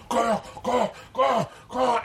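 high, repeated choking noises; imitated panicked choking. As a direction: mimic a diver gagging in panic. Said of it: "you can watch her freaking out".

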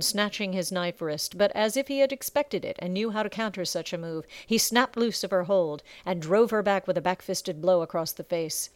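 usual speaking voice

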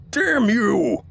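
very high, desperate yell. A narrator's reading. Dying sergeant curses the captain, yelling. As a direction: yell it as the beasts swarm. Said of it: "he yelled".

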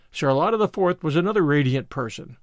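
ordinary delivery, nothing marked

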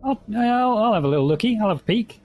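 high playful voice. Acted. Player voice peeking at door.

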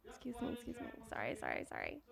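off-mic apologies, far and faint; talking away from the fallen mic; after dropping mic. Real, not acted. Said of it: "My, my mic fell".

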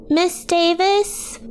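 put-on high, slow little-girl voice; tiny cooing child. A narrator's reading. Little Sue to her teacher, cooing. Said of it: "Sue's tiny voice cooed".

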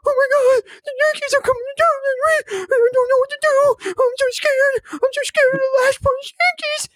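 falsetto whimper, high and wailing; mocking scared fan. Mocking his cohost as a frightened fan, high fake whimper. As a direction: whine it like a terrified baby. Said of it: "and you're like".

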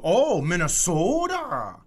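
high, drawn-out Minnesota O's; mock Minnesota accent. Imitating people who hear you're from Minnesota, exaggerated Minnesota accent. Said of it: "you're from Minnesota and they go".

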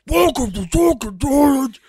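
loud high drawn-out scream. Retold or imitated. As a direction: bellow the greeting at full volume. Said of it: "he just comes on screaming".

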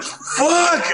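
high-pitched cursing cry; retold panicked shout. Runner seeing the bus drive off, panicked shout.

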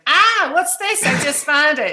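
high, loud, startled questioning voice; alarmed finder. Retold or imitated. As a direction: playfully imitate someone who just found a scary bug. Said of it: "People are always putting it up on Facebook going".

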